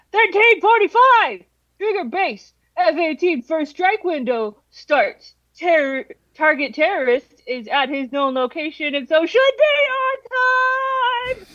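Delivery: high hyped frat bro announcement. Pretend. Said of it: "my impression of a frat bro".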